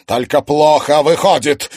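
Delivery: loud, high angry snap. Acted. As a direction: bite it out in anger. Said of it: "разозлился Сергей Арсентьевич".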